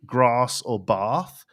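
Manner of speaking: "grass" and "bath" as "grahss" and "bahth"; long A. Shown as a demonstration. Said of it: "would give it a longer".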